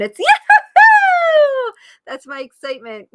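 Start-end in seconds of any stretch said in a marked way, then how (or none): 0.2-1.7 a huge, high, stretched-out yahoo; excited yahoo. Shown as a demonstration. Coach demonstrating her excitement sound, huge and high. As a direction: shout it out with full joy. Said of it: "I have one for excitement".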